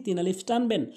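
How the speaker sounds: ordinary delivery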